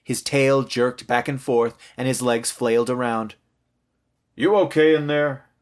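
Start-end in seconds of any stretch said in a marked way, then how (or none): 4.3-5.5 raised, higher voice through a door; dad checking in from outside the room; calling out. A narrator's reading. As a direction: call to someone behind a door. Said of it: "Daddy called from the other side".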